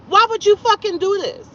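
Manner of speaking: high-pitched, indignant retold question; exasperated demand. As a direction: demand an answer, voice way up. Repeats the question, escalating.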